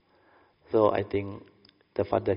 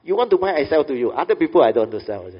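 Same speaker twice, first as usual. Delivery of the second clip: imitating the son, much higher and louder, eager; loud, high, pushy sales pitch; excited insistence; 5 semitones higher, 12 dB louder, faster than usual. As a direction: play the eager seller who only trusts you. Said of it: "He replied back".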